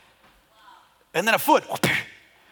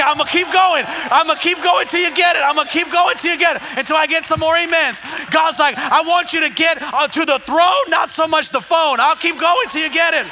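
loud, high-pitched hype preaching; full-force preaching; 10 semitones higher, 7 dB louder than usual. Real, not acted. Preacher working the church for amens, full-force preaching. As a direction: push the congregation for a response.

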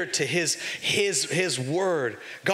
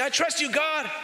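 mock hollow promise; 10 semitones higher than usual. Mocking a shallow claim of trust.

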